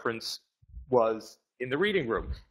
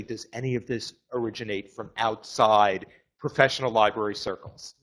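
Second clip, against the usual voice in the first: normal talking voice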